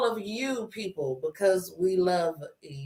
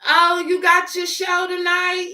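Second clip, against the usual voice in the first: grandma voice; 10 semitones higher, 4 dB louder than usual. Retold or imitated. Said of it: "grandma voiced".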